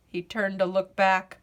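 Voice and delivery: ordinary delivery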